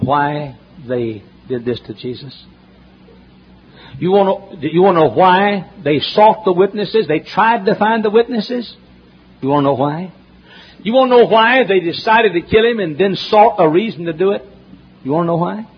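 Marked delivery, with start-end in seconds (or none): 4.0-15.7 preaching at full force. Acted. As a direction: thunder the question at the congregation. Preacher building to climax.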